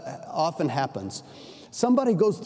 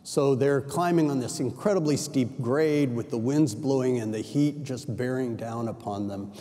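ordinary talk, nothing marked

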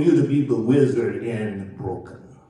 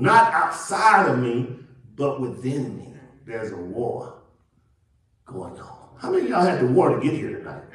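ordinary delivery

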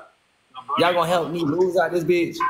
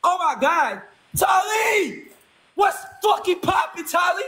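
excited shout; 12 semitones higher, slower than usual. Real, not acted. Streamer shouting thanks to gifter Tali, excited yell. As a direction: hype him up at the top of your lungs. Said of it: "You got me lit for a second".

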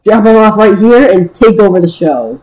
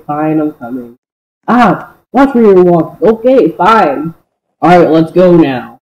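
usual speaking voice

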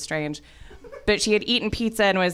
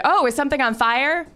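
higher, lightly voiced alarmed question; worried older actress; 8 semitones higher than usual. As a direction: lightly imitate an older woman's concern. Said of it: "she said".

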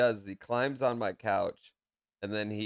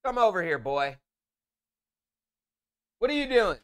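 raised, higher voice calling to a son; 9 semitones higher than usual. Real, not acted. Dad calling son across room.

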